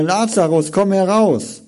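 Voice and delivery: high ringing call into the tomb; loud call. Acted. Jesus calls Lazarus from the grave, loud cry. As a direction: call him out with full voice. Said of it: "rief er mit lauter Stimme".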